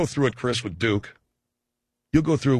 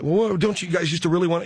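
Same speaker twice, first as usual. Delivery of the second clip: pleading parent; 6 semitones higher than usual. Retold or imitated.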